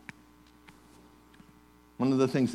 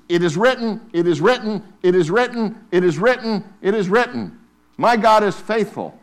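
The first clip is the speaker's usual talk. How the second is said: loud, high, repeated "it is written"; preaching at full force; 9 semitones higher, 10 dB louder than usual. Acted. Preacher urging the church to wield scripture, full force. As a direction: chant it like a battle cry.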